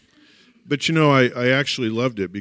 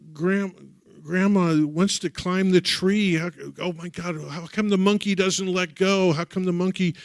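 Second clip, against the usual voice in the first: put-on childlike distress; 8 semitones higher, 5 dB louder than usual. Child's panicked plea to grandma.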